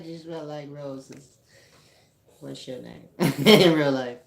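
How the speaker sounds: flirty player voice through laughter; Mac voice. Pretend. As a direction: lay on the smooth pickup charm, laughing. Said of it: "that's my Mac voice".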